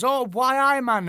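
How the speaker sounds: loud, high-pitched Geordie caricature; swooping, exaggerated Geordie impression; mock Geordie accent. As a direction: ham up a Geordie exclamation. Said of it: "Geordie, Geordie".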